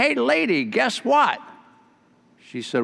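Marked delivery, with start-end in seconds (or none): 0.0-1.4 high cheeky parrot taunt. Acted. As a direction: tease her like a rude parrot.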